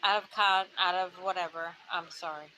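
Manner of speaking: tipsy, loud and slurring. Real, not acted. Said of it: "I'm just a little tipsy".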